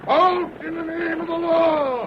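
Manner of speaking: high, loud call to stop the coach; shouted halt. Acted. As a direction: bellow it down the road. Sheriff calling stage to halt.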